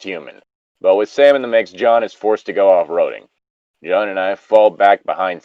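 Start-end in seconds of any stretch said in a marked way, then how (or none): none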